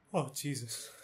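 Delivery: stunned murmur. Acted. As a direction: barely get the words out. Sees girl hit on road.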